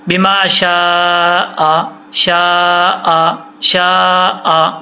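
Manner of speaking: بما شاء with شاء stretched and repeated; slow recitation. Shown as a demonstration. Teacher shows the pause reading with two zabar, slow. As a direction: draw out شاء slowly. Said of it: "تو پھر کیسے پڑھتے؟".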